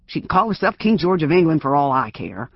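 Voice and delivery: put-on gruff old self. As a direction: threaten through the door, building to a yell. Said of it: "sounding more like his old self".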